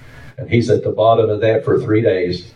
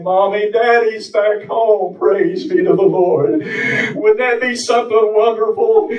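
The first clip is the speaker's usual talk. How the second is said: high, sustained revival chant; impassioned preaching; 11 semitones higher than usual. Real, not acted. Preacher urges congregation to cry out to God, impassioned chanting. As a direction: preach it high, driving each phrase to the congregation.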